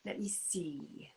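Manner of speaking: whispered to herself, slow; slow whisper while searching. Real, not acted. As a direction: mutter it slowly under breath. Thinking to herself.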